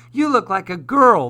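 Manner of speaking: high, loud jeer; shouted taunt. A narrator's reading. Other kids point and laugh at Felix, shouted taunt. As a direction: call it out to mock a classmate. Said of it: "they shouted".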